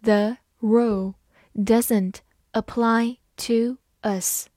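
slow, careful classroom read; slow teacher demo. Shown as a demonstration. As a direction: read it slowly word by word. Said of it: "好，慢慢来读".

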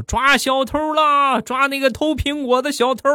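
very high, drawn-out mock yell; acted street shout, stretched and shrill-pitched. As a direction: raise the alarm like bystanders calling out. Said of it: "旁边几个小伙儿冲着我大声地喊道".

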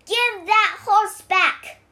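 high, loud, slow command; shouted demand. A narrator's reading. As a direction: shout each word as an order. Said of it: "shouted Wukong".

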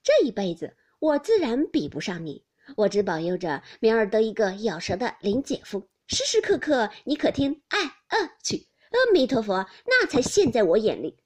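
loud, gleeful comeback; laughing teasing. A narrator's reading. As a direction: tease her with mock prayers. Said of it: "湘云笑道".

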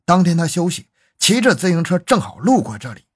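ordinary delivery, nothing marked

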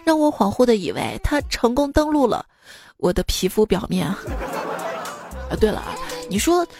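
normal talking voice

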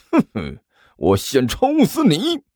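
snicker, then draw the threat up high; cold laugh. A narrator's reading. Guan Xiaoyu raises a palm at Zhang Yefei, cold laugh. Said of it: "冷笑了一声".

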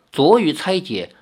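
usual speaking voice

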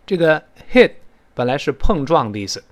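usual speaking voice

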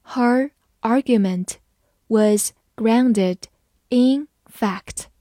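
broken into short phrases; phrase-by-phrase model reading; slow reading. Shown as a demonstration. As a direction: read slowly, chunk by chunk for learners. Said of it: "我们慢慢来读".

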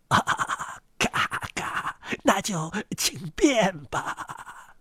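put-on soft, husky, very slow old duck; hushed, creaky duck voice. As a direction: drag out a dismissive old duck's reply. Old duck's voice.

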